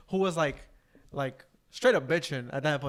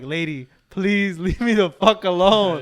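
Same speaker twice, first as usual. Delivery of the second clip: much louder, slow, laughing plea; exasperated outburst; 4 semitones higher, 10 dB louder than usual. Retold or imitated. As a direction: beg to be left alone, half laughing. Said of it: "she was like".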